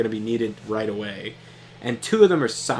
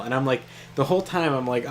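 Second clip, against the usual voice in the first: usual speaking voice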